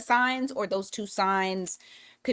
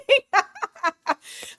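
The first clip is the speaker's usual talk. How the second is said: high, loud burst of laughter; real laugh; 12 semitones higher, 6 dB louder than usual. Real, not acted. Reader laughing at getting tongue-tied, high loud laugh. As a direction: crack up at your own stumble.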